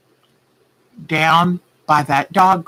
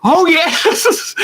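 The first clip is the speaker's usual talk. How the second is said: excited; 10 semitones higher than usual. Retold or imitated. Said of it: "She goes".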